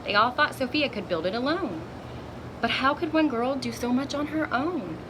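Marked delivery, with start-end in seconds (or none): none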